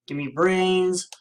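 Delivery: slow groaning zombie voice. Pretend. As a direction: moan it like a hungry zombie. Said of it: "I'm gonna sound like a zombie".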